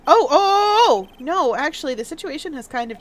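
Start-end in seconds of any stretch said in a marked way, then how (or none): 0.1-1.1 surprised realization. Acted. Character suddenly realizing.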